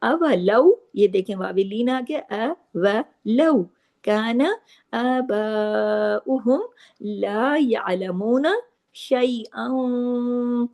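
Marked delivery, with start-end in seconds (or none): 3.3-10.7 measured, drawn-out chanting of the verse; slow Quranic recitation. Shown as a demonstration. Teacher reciting the verse slowly for students. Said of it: "देखिए शैअन".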